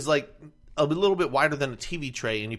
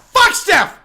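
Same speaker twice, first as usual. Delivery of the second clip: loud, high curse; angry yell; 12 dB louder than usual. Retold or imitated. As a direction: spit it out at full volume. Retold angry outburst.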